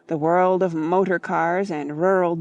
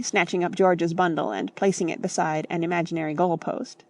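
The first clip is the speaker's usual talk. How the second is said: plain reading voice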